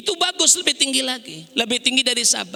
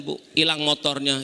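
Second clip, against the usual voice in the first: normal talking voice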